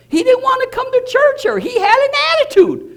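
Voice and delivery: high, loud sulky mimicry of him; mocking attitude. Pastor mocking the reluctant uncle, high and sulky. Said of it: "he had an attitude about it".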